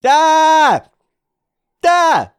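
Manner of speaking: sudden high shrieks of alarm; startled screams. Real, not acted. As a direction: yelp in real fright at the ambush. Sudden attack in fight.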